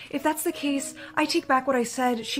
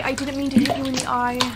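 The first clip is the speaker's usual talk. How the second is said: ordinary talk, nothing marked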